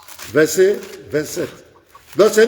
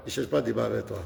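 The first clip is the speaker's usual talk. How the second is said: much softer, lower repeat of the same line; muttered to himself, firm; quiet repeat; 9 semitones lower, 11 dB quieter, faster than usual. Real, not acted. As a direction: drop the voice low and soft on pas.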